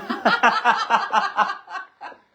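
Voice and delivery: bursting into loud high laughter. Real, not acted. Big laugh at partner's remark.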